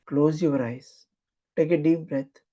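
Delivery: calm guiding voice with long pauses; guided meditation. Acted. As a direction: lead them inward, unhurried. Leads a guided visualization.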